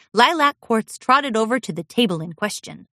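usual speaking voice

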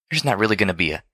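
ordinary delivery

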